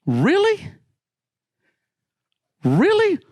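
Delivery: high, loud incredulous "Really?"; incredulous outburst. Retold or imitated. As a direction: fire back in disbelief, voice jumping up. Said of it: "I said".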